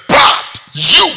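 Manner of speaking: loud. Acted. Preacher starts verse loudly.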